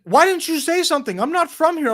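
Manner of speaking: imitating the driver's high, loud yelling; mock yell. Driver blames the lost passenger, a high, loud mock yell. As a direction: throw the blame back, voice up and loud. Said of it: "he's kinda yelling at me".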